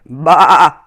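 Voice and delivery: loud bleat; lamb bleat. Acted. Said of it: "But she bleated".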